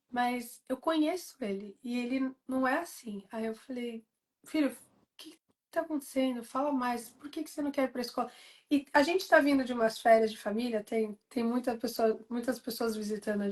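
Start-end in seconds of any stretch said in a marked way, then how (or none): 4.5-8.3 soft, gentle probing of a worried son; mother quietly coaxing her son to say why he won't go to school, soft and gentle; gentle questioning. Retold or imitated. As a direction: ask softly, coaxing him to open up.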